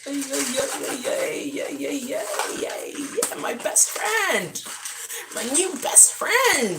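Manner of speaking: high yay chant, then slow high praise; high sing-song cheering; excited cheer. Real, not acted. Cheering for the supplement.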